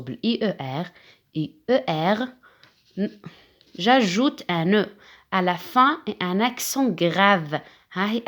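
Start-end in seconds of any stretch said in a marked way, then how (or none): 5.3-7.6 slow dictation. Shown as a demonstration. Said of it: "teacher reads rule slowly".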